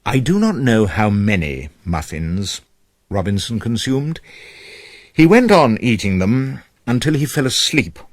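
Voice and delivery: usual speaking voice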